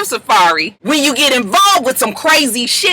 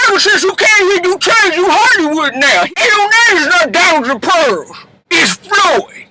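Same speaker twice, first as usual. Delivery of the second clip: acted scolding shout; 6 semitones higher than usual. Voicing mother scolding her son.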